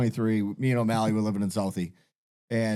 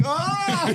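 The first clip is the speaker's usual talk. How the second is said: high-pitched drowning yell; a drawn-out, hammed-up scream of dying; pretend dying scream. Man acting out how he should have faked drowning, mock scream.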